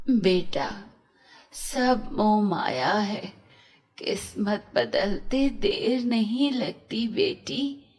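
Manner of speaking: put-on old woman gently telling the girl fate can change, slow. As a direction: speak slowly like a wise old beggar woman. Old woman speaks wisely.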